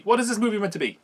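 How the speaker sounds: high-pitched, incredulous question falling away; exasperated bafflement. Real, not acted. Reviewer asking again what the film is meant to be, exasperated. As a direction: repeat it with rising disbelief.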